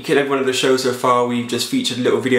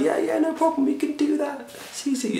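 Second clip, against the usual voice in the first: breezy nonchalance; 12 semitones higher, 7 dB quieter than usual. Pretend. Voices doctors brushing it off.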